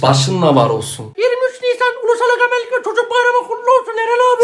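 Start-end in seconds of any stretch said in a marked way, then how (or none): 1.2-4.4 put-on high fast child voice; little kid voice. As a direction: rattle it off like a small boy. Kid voice rushed greeting.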